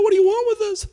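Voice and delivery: very high shrieking demon voice; hammed-up high demon scream. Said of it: "they screamed out".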